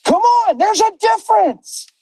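high, driving preacher's cry with shouted peaks; preaching at full force. Acted. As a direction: lift the room like a pulpit.

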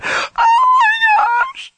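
slow, very high wailing squeal; overwhelmed squeal. Retold or imitated. Drive-in girl feeling her pain leave, overwhelmed wail. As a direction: imitate a girl crying out, drawn out.

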